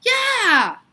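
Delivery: piercing high scream, very loud; girl shrieks when her pigtail is yanked. A narrator's reading. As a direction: let out a shrieking yell of pain. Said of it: "Leslie screamed".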